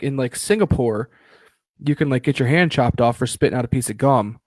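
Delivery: normal talking voice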